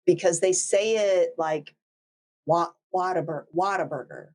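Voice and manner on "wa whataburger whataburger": Whataburger said Texan-style as WAH-ter-burger, open first vowel, no r after it; Texan pronunciation. Shown as a demonstration. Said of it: "by how they pronounce".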